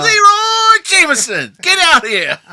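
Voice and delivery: very loud, high drawn-out yell; hollering summons. Pretend. Calling the prop master out, hammed-up holler. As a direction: bellow the name across the set, hammed-up.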